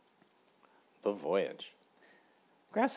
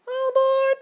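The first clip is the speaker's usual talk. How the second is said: very high, loud mosquito call; 13 dB louder, slower than usual. A narrator's reading. Mosquito calling Grasshopper aboard, very high and loud. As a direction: call everyone aboard like a tiny captain. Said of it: "called the mosquito".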